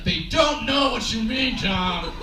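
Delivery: loud, high, drawn-out shout; body shouting warning. Acted. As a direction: shout it slowly at yourself in exasperation. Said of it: "every bone in my body's like".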